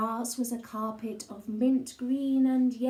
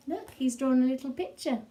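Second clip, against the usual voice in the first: ordinary delivery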